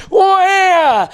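put-on loud high baby wail; a shrill wailing baby cry, loud. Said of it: "so they just go".

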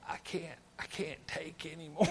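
small, soft, worn-out voice; mock exhaustion. Preacher imitates overloaded listeners, mock exhausted. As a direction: play mentally spent, barely any volume, for a laugh. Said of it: "you're so mentally tired".

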